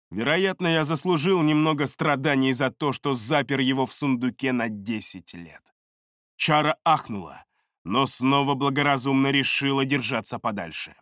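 ordinary delivery, nothing marked

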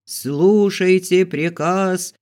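high, slow, loud proclamation; herald's cry. A narrator's reading. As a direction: proclaim it to the whole yard, stretching the words. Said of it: "голос глашатая прокричал". (протяжный крик глашатая)

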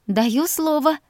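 high, eager vow; eager promise. Acted. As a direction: promise brightly and quickly. Eager promise, pitch jumps high.

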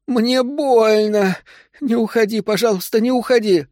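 wailing plea. A narrator's reading. Wife in labour begs husband not to leave, high wailing. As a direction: beg him to stay, voice pitched far up. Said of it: "завыла Вера, вцепившись в мужа".

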